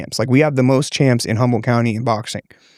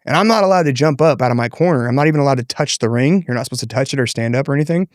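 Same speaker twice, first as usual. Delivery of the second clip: ordinary delivery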